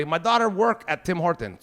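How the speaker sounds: normal talking voice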